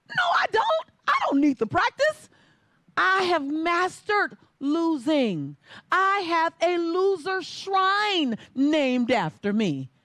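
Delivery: put-on teen shouting back at the coach; desperate protest. Arguing with coach.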